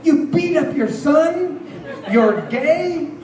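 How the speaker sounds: high, indignant imitation of angry friends; hammed-up outrage, pitched high; mock outraged accusation. Friends confronting him about the other Richard Hatch, mock outrage. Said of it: "they were pissed off at me".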